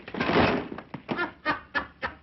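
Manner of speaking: high, breathy gloating laugh; gleeful laugh. Acted. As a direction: cackle with glee at the trick. Gloating over the phone trick.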